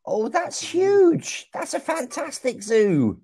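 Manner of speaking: ordinary talk, nothing marked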